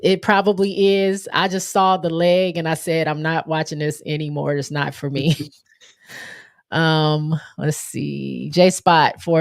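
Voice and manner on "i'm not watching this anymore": ordinary talk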